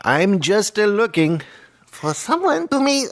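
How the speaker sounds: high-pitched, badly faked Indian accent; mock Indian accent. Shown as a demonstration. Co-host in an accent guessing game, Indian accent. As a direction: climb into a sing-song Indian accent. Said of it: "an Indian or a Pakistani".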